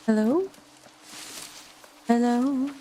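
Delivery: ordinary talk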